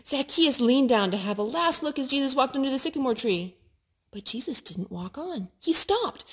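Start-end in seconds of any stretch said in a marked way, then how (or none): none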